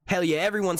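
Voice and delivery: ordinary talk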